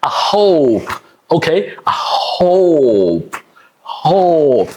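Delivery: "hope" with a stretched O; slow, drawn-out "hope"; long O hope. Shown as a demonstration. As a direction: push air on H and hold the O in "hope". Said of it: "It's a O".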